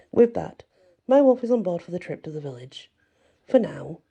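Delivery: usual speaking voice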